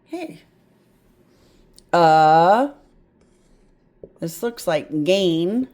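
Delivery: ordinary talk, nothing marked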